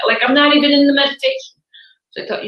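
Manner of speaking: normal talking voice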